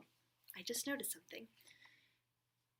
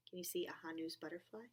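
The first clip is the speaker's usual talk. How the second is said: ordinary delivery, nothing marked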